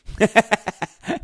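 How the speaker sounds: loud, high burst of laughter. Real, not acted. Reading a viewer's comment, bursting into laughter.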